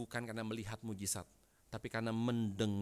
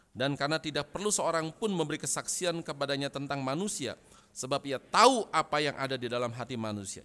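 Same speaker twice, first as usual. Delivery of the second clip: loud, declaimed Bible reading; congregational scripture reading; 4 semitones higher, 8 dB louder than usual. Real, not acted. Preacher leading the church in reading Yohanes, loud and declamatory. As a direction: proclaim the verse for all to follow.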